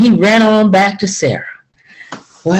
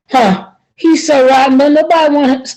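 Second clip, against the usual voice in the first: higher, scornful scoff; 7 semitones higher than usual. Acted. Zed scoffs at his greedy neighbour, sneering.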